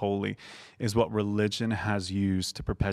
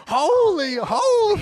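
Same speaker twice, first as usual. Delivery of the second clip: put-on loud, high, drawn-out Holy, holy in his accent; imitating the pastor's accented preaching; 8 dB louder, slower than usual. Imitating the pastor preaching Isaiah six to a congregation, accented and loud. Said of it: "your West Virginia slash Ireland slash whatever else accent".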